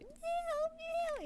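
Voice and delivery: very high-pitched imitated meows; tiny, cute, high cat voice; cute happy cat. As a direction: squeak like a happy cat greeting its owner. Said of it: "really high-pitched and cute".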